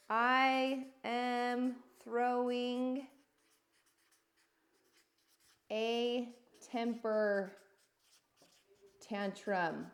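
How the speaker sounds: slow confession. Real, not acted. Confessing own tantrum slowly.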